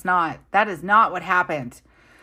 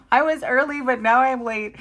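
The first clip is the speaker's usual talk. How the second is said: much higher, louder sing-song whine; whiny excuse; 9 semitones higher, 6 dB louder than usual. Retold or imitated. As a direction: whine it to mom like a kid. Telling mom on the phone.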